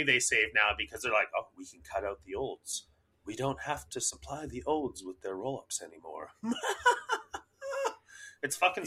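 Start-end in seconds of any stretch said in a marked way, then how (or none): 1.4-6.3 soft, conniving mock executive; scheming company voice. Company plotting to save money on seniors, hushed scheming. 6.3-7.4 high, soft evil cackle; mock villain laugh. Company gloating over cutting out seniors, mock evil laugh.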